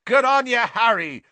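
loud, high cheering shout; roar. A narrator's reading. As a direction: roar congratulations over a crowd. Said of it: "roared Seamus Finnegan".

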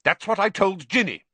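high, loud fierce retort. Acted. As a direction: fire back fiercely. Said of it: "said Percy fiercely".

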